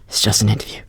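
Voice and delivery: hushed, whispering to yourself; steady yourself in a soft whisper; whispered self-reassurance. Acted. Nervous applicant calming down mid-interview, whispering to self.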